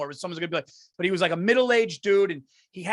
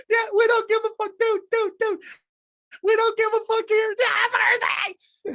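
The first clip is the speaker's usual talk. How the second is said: high, loud, strained party-guy yell; hammed-up drunk Pittsburgh bro shouting; impression of a rowdy friend; 4 dB louder than usual. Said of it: "He goes".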